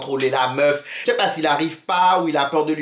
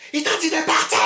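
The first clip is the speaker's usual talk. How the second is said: high, insistent yell; acted yell; 13 semitones higher than usual. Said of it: "je commence à crier".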